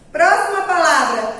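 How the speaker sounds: ordinary delivery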